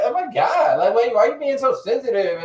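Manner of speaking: high, loud, laughing mock girlfriend voice. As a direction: ham up her dismissive whine. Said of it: "I'm like".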